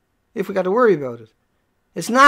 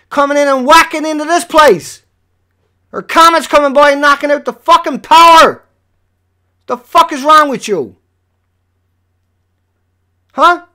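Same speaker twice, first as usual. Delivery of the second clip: raised, heated scolding of a chat viewer; angry rant; 5 semitones higher, 6 dB louder than usual. Real, not acted. Streamer scolding a viewer about planets, angry shouting. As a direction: lay into him, loud and higher.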